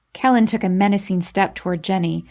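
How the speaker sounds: usual speaking voice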